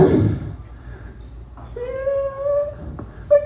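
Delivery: long high fake sob; hammed-up high-pitched bawling squeal; mock crying wail. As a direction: imitate an ugly-crying wail. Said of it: "One of them's just ugly crying".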